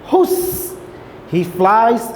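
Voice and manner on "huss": unvoiced high whoosh of wind; whooshing wind sound. Teacher to class, mimicking the wind whooshing. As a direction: blow it out like a gust.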